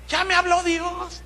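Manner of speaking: lifted, high-pitched imitation of someone reporting back; congregant's quoted reply. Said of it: "me dice".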